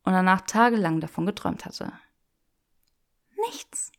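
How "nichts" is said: barely audible doubting whisper; whispered echo. Acted. Granger doubting Draco's answer, whispered. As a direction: breathe the word in disbelief.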